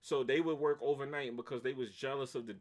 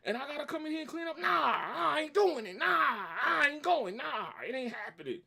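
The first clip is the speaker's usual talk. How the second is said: [name]; 11 semitones higher, 6 dB louder than usual. Retold or imitated. Worker refuses cleanup before boss, screaming and going crazy. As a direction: build to screamed, stretched-out refusals. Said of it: "I turned it to [name]".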